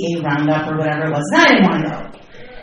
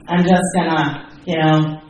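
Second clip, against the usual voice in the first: ordinary delivery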